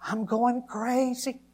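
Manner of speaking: high, loud anguished screaming, drawn out; full-force cry of breakdown; reenacted desperate scream. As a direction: scream to God with everything in you. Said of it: "screaming out with everything that was in me".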